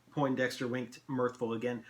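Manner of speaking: plain reading voice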